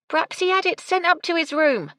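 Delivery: high, loud yell back. A narrator's reading. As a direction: shout across the kitchen. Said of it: "Gertie yelled back".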